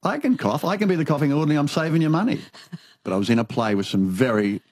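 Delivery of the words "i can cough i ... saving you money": eager plea. Retold or imitated. Pleading with Tim for part.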